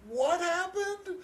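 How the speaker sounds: very high, stretched-out disbelief; imitated wide-eyed amazement; mock astonishment. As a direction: squeal it like a stunned moviegoer. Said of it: "crazy scene that's just like".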